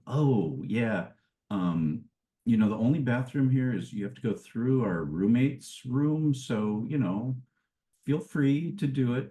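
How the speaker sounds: hesitant, evasive imitation of the hosts; cagey. Hosts explain the bathroom to their guest, mildly cagey. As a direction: hedge awkwardly, pitch lifting toward a reluctant offer. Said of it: "very kind of cagey".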